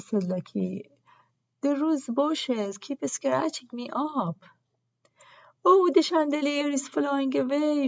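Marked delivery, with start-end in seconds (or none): 1.6-4.4 whiny complaint. A narrator's reading. Lucky complaining about rose scratches. 5.6-7.9 high alarmed shout. A narrator's reading. Roselle shouts as the chandelier flies away, high alarmed cry. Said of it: "shouted Roselle".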